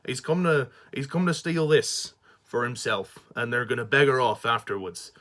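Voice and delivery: amateur West Country Somerset burr; Somerset accent. Acted. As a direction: play a mock Somerset country man. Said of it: "he puts on a Somerset accent".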